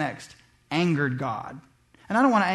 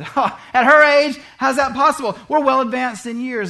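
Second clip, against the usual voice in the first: incredulous scoff; 5 semitones higher, 7 dB louder than usual. Retold or imitated. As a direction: scoff at the news as if it's impossible. Doubting Zacharias scoffs.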